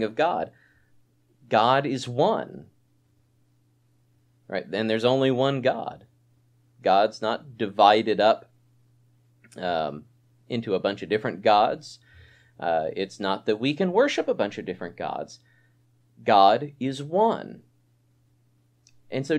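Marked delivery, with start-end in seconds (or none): 1.5-2.7 spaced and weighty words; slow emphatic. Acted. Preacher drives home doctrine. 16.2-17.6 spaced and weighty words; slow emphatic. Acted. Preacher repeats doctrine for weight.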